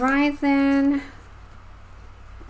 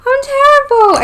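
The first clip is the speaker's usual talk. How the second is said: squealing self-deprecation; 9 dB louder than usual. Real, not acted.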